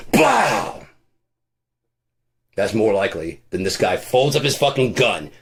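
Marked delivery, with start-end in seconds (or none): 0.1-0.9 mock gunshot. Imitated gunshot after knock.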